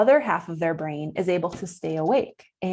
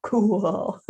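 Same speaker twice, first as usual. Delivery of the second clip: breathy gasp, then a drawn-out "cool"; awed little "oh, cool"; impressed "cool". Acted. Teacher playing on the double meaning of cool, mock-impressed. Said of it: "cool, both, like, really cold and also, like".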